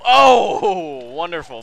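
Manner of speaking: sudden loud gasp of surprise; a real startled oh at clearing it; surprised oh. Real, not acted. Surprised at first try.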